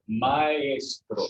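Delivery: "maestro" slowly, in Spanish, ma-ES-tro; Spanish model pronunciation. Shown as a demonstration. Teacher modelling for class to repeat, "maestro" as ma-ES-tro. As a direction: model "maestro" with a drawn-out middle syllable. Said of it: "Can everyone say that?".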